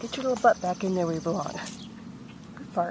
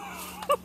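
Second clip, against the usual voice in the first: high, soft breathy laugh after a fright; startled laugh; 9 dB quieter than usual. Real, not acted. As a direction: laugh off the surprise.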